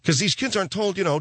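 usual speaking voice

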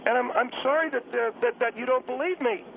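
lightly voiced kid, apologizing hesitantly; apologetic young caller.

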